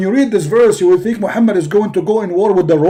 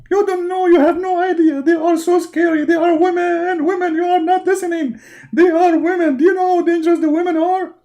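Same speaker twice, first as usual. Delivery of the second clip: high, pleading, panicky complaining; whiny prophet; 7 semitones higher than usual. Acted. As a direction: whine about scary wives. Muhammad panics about women.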